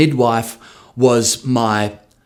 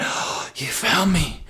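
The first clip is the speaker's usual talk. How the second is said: stoned himself; 7 semitones higher than usual. Retold or imitated. High on mushrooms, greeting his wife who found him, dreamy. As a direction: play lightly at being high on mushrooms. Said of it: "I went".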